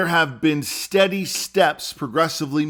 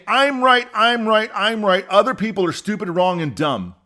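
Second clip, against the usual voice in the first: mocking a know-it-all; 6 semitones higher than usual. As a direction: parody a stubborn partisan.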